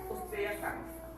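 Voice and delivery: tearful quote. Real, not acted. Retelling doctor's harsh verdict, tearful.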